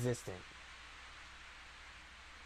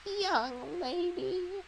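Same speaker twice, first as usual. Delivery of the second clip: put-on old woman voice; much slower than usual. Shown as a demonstration. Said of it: "I can't do an old woman".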